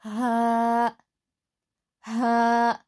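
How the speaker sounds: हा from the lowest throat; Arabic ha. Shown as a demonstration. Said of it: "हलक के नीचे वाले हिस्से से हा".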